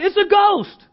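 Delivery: mock-panicked high shout; terrified cry. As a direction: blurt it out loud and high, as if terrified. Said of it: "were terrified".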